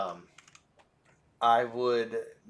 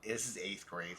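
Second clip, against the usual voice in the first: ordinary delivery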